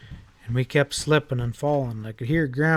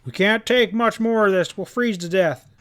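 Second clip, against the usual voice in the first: loud, high desperate call; shouting over wind; 7 semitones higher, 3 dB louder than usual. A narrator's reading. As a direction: yell over the roar that they'll freeze. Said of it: "Pa shouted over the roar of the wind".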